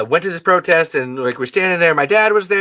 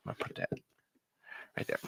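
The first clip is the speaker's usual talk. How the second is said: normal talking voice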